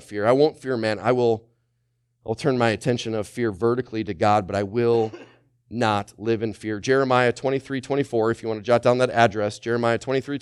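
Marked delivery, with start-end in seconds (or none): none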